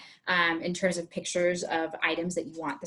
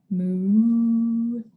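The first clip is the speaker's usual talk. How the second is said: long, louder drawn-out moo; mock moo; 5 dB louder than usual. Shown as a demonstration. Teacher mooing to show the cow.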